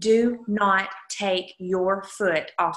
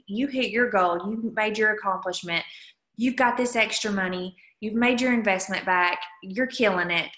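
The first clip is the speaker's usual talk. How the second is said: ordinary talk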